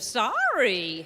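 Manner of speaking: mock defensive "Sorry", shooting up in the middle; exaggerated insincere apology with a pitch peak. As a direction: swoop the pitch up mid-word, defensively. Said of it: "Then they get all defensive".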